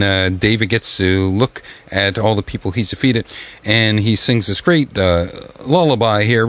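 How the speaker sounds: ordinary talk, nothing marked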